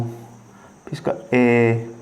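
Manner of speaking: ए held very long and slow; drawn-out vowel. Shown as a demonstration. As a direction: stretch the ए out slowly. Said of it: "आवाज़ को मैं खींच कैसे रहा हूँ".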